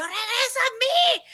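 very high, slow dramatic wail; reenacted frantic cry; panicked plea. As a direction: call someone back in hammed-up desperation. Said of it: "yo me asusté mucho".

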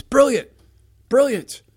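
excited brilliant. Real, not acted. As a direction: cheer the neighbor's answer. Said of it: "exclaims about franchises".

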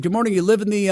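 a hint of the chatty neighbor; lightly voiced neighbor. Neighbor voiced in retold story.

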